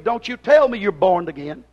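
usual speaking voice

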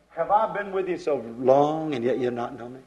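low, soft and unhurried; calm. Retold or imitated. Jesus calmly asks his disciples, low and soft. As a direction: gently ask as a patient teacher. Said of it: "Jesus would just calmly and collectively say".

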